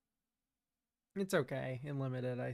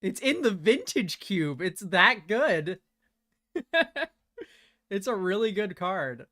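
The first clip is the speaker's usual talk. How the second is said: excited; 6 semitones higher, 6 dB louder than usual. Real, not acted. Hyping the card.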